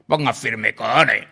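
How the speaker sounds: loud, gruff officer's command; a lieutenant shouting at a recruit to stand firm; barked order. Retold or imitated. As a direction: bark it like a lieutenant on the parade ground.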